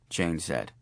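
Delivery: ordinary delivery, nothing marked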